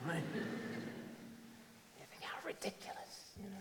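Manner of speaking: whispered aside through a laugh; amused whisper. Acted. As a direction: drop to a near-voiceless whisper. Laughing aside at own misprint.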